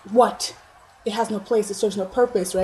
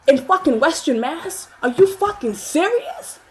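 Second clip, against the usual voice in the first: high, loud, slow incredulous outrage; outraged disbelief; 7 semitones higher, 5 dB louder, slower than usual. Retold or imitated. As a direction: hurl the question at him, appalled. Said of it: "I was like".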